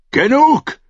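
sudden high-pitched angry shout; roaring. A narrator's reading. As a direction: cut him off with a roar. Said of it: "brüllte Stannis". (wütend losbrüllen)

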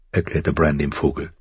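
usual speaking voice

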